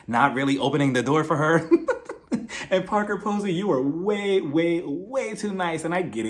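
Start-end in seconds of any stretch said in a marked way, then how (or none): 1.7-2.7 high breathy laugh; amused high laugh. Real, not acted. Laughing at Jocelyn ignoring Parker. 2.7-5.8 laughing. Real, not acted. Laughing, addressing Parker.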